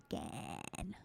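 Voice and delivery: Gann in a broad Australian accent, low and soft. Shown as a demonstration. Host trying out a judge's name, Australian accent. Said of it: "I can say it with my Australian accent".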